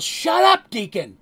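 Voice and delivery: high, very loud outburst; angry yell. Acted. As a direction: yell it to silence a friend. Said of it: "And Mugen yells".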